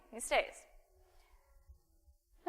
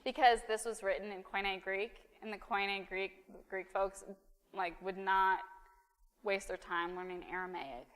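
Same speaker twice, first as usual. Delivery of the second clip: ordinary delivery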